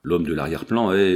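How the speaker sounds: ordinary delivery, nothing marked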